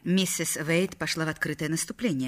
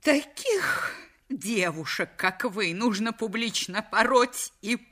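медленно, с нарастающим негодованием; выплёвывает слова с презрением; гневный возглас; 5 semitones higher, slower than usual. A narrator's reading. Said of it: "воскликнула миссис Уэйт". (жена в ярости бросает сопернице обвинение)

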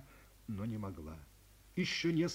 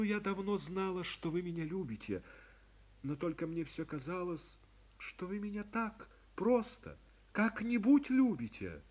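usual speaking voice

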